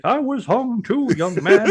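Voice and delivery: put-on high, slow old man voice; quavering old codger. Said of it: "he's like".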